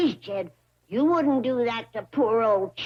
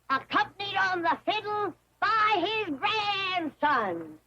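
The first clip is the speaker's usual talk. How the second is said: carnival barker drawing in a crowd; street performer; 4 semitones higher than usual. Acted. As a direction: hawk it to passing fairgoers. Medicine show barker pitch.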